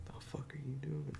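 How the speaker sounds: unvoiced whisper of shock; hushed what-the-hell whisper; whispered disbelief. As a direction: breathe it out in disbelief. Her own disbelief retold.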